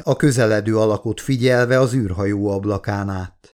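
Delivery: usual speaking voice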